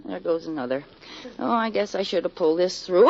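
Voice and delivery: ordinary talk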